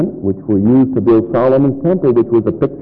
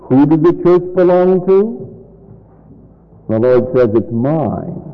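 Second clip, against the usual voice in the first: ordinary talk, nothing marked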